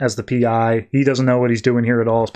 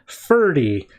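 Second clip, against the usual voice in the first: usual speaking voice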